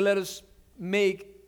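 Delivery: ordinary talk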